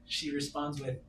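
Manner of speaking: normal talking voice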